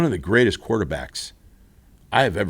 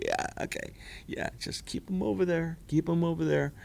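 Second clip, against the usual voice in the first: much softer conspiratorial aside; hushed aside; 4 semitones higher, 11 dB quieter than usual. Pretend. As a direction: mutter it out of Terry's earshot. Aside, hushed, keep him away.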